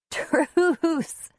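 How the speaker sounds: high, breathless mock plea; begging for truce. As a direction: beg for mercy in play. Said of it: "Antonia would beg".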